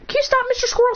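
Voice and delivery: pleading with a squirrel, way up high; high-pitched plea. Real, not acted. Speaker to a staring squirrel, high-pitched plea. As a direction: ask it in a comically high voice. Addresses a squirrel watching them.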